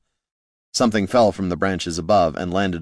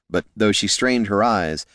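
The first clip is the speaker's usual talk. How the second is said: ordinary delivery, nothing marked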